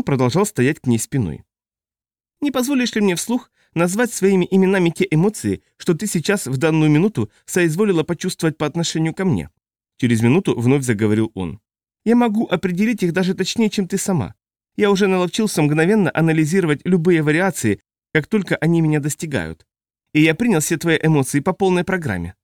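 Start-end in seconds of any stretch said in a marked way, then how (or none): 2.4-9.5 high, testy, prickly man's voice; irritable high-pitched man. A narrator's reading. Ozden's voice again. 12.0-22.3 high, testy, prickly man's voice; irritable high-pitched man. A narrator's reading. Ozden's voice again.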